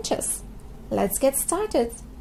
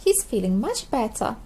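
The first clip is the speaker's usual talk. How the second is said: plain reading voice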